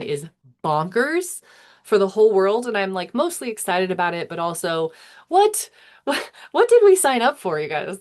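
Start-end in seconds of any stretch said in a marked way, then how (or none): 5.3-7.6 high, loud burst of disbelief; incredulous outburst. Acted. To viewers about a bonkers year ahead, incredulous outburst. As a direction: blurt it out, astonished and loud. Mock alarm at a wild year.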